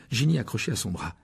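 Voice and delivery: usual speaking voice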